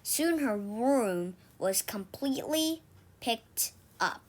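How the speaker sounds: ordinary delivery, nothing marked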